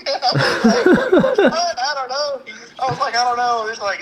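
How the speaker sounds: words squeezed out through high laughter; can barely get it out for laughing; real laughter bubbling over the quote. Real, not acted. Laughing while retelling the call.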